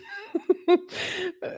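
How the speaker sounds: high giggling fit; real laugh. Real, not acted. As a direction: giggle helplessly. Laughs at the messages.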